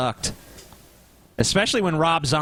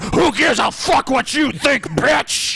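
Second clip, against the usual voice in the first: slow, high taunting snarl; Freddy Krueger voice; 9 semitones higher, 5 dB louder, much slower than usual. Retold or imitated. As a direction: sneer it like a horror villain. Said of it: "And Freddy's like".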